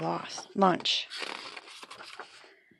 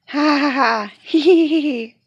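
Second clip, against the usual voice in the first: high, loud giggling; big excited giggle; delighted laughter; 7 semitones higher, 8 dB louder than usual. Acted. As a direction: laugh with glee at the prank. Laughing after the scare.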